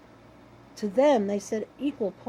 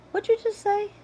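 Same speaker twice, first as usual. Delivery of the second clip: high, louder "What'd you just say?" in mock confusion; puzzled question; 12 semitones higher, 5 dB louder than usual. Asking her friend to repeat herself, mock-puzzled and high. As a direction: pretend you just can't catch her words.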